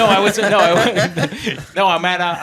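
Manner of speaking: laughing. Real, not acted. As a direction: laugh while denying it. Laughing at listener's question.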